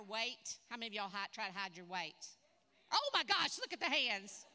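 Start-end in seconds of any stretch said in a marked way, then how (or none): 2.9-4.4 surprised exclamation. Real, not acted. Surprise at raised hands.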